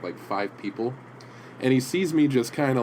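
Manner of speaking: ordinary talk, nothing marked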